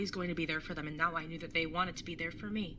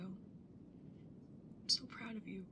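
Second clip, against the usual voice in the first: slow, much softer proud of you; tender pride; 11 dB quieter, much slower than usual. Acted. Brother telling his sister he's proud, soft and slow. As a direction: let it land softly.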